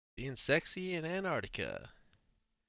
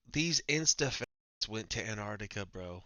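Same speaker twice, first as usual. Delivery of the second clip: ordinary delivery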